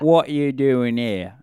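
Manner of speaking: slow, loud mock British accent. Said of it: "sort of a British accent".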